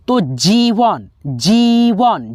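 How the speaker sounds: जीवन said very slowly, the ई held long; drawn-out long ई. Shown as a demonstration. Teacher showing students the long ई in जीवन, slowly stretched. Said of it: "यानी खींच करके है".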